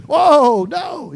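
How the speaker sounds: high, loud startled no; mock recoil. Churchgoer recoils from Revelation, high mock fright. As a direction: recoil at the word. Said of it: "you know".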